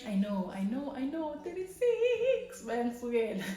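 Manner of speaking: a playful shriek of mock horror at turning thirty-six; mock dismay. Real, not acted. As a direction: cry out in playful disbelief. Mock dismay at her age.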